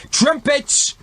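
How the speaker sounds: very high angry shout. Acted. King angrily shouts for his trumpeters. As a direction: bark the call for trumpets. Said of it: "the king shouted, still angrily".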